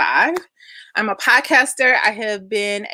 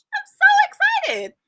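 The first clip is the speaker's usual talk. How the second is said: excited shout; slower than usual. Real, not acted. Host greeting viewers.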